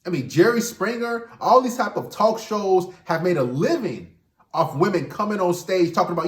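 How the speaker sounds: ordinary talk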